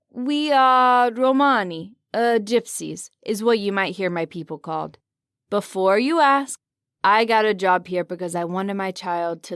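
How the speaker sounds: a hint of a proud Romani grandson; Italian accent. Jal explains his people to the visitor, Italian accent. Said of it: "in a heavy Italian accent".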